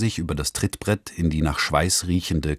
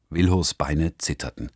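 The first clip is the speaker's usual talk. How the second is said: ordinary delivery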